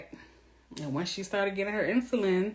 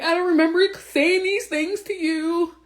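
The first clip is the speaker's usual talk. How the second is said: higher, slow, louder whine; mock whiny man; 9 semitones higher, 5 dB louder than usual. Mocking the crying husband at the reunion, whiny. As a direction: play him as a crying innocent. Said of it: "He was like".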